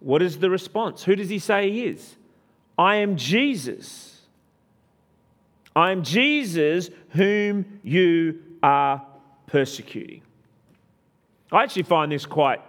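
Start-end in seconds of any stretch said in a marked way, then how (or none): 2.8-10.2 solemn Jesus. Acted. Voicing Jesus' reply.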